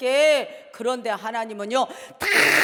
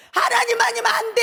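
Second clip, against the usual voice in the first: shouted cry; 8 semitones higher, 5 dB louder than usual. Shown as a demonstration. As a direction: cry it out to the people. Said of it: "여러분에게 외치고 싶은 거예요". (외치는 소리)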